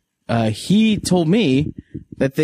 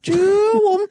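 train whistle sound. Pretend.

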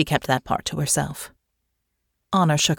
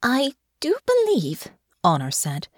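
careful realization; 8 semitones higher than usual. Acted. Honor realizes the misunderstanding.

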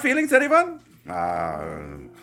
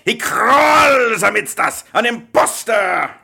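slow, loud, high denunciation; furious outcry; 8 semitones higher, 6 dB louder, slower than usual. Acted. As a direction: thunder it out, outraged. Father denouncing the guest.

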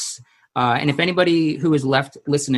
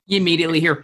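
ordinary delivery

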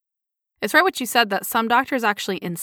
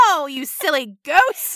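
slow, squeaky little hamster voice; tiny critter scolding slowly; squeaky nibbling hamster; 5 semitones higher, slower than usual. Pretend. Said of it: "making little hamster noises like".

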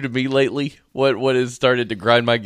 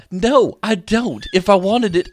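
high, slow, emphatic refusal; raised, fed-up retelling; exasperated retort; 5 semitones higher than usual. Retold or imitated. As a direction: tell off the machine in mock outrage. Retold snapping back at device.

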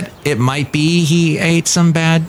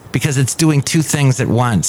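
ordinary delivery

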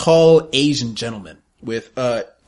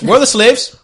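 loud, high, offended question; indignant demand; 10 semitones higher, 8 dB louder than usual. Acted. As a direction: demand it loudly. Atlantean asks for slaves.

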